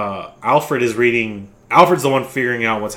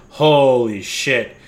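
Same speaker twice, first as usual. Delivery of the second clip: stunned holy shit; much slower than usual. Retold or imitated. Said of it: "we're like".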